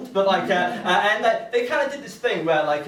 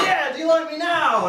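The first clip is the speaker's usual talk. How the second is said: high, loud show-off cry; mock exhibitionist yell; 9 semitones higher, 5 dB louder than usual. Comic to his heckler after taking off his top, mock show-off yell. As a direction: crow it to the room, pitched right up. Acts out having stripped for heckler.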